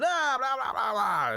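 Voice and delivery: high, loud hammed-up scream in nonsense syllables; mock screaming. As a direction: imitate a woman shrieking, starting very high and easing down. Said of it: "And she's screaming".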